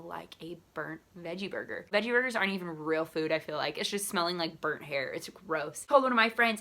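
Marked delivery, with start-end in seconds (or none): none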